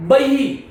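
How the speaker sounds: बही pronounced "bahihi", echoing the i; correct visarga. Shown as a demonstration. Teacher models the word for students, बही as "bahihi". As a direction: end बही on a breathed "hi". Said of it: "इसको हम क्या बोलते हैं".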